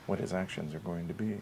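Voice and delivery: hushed, very soft golf commentary; whisper-soft like a golf broadcast; golf announcer. Acted. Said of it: "Golf, golf announcer".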